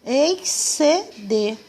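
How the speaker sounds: "exceder" slow, in three beats ex-ce-der; stretched syllables in "exceder"; syllables separated. Shown as a demonstration. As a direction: sound out "exceder" piece by piece. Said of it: "quando a gente separa as sílabas".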